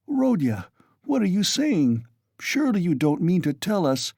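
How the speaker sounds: high, alarmed mother's plea; a mother startled by her son's words; mild alarm, read aloud. A narrator's reading. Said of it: "began in alarm".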